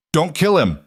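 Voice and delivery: high frantic plea; screaming. A narrator's reading. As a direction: yell it in panic. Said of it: "Marcus screamed".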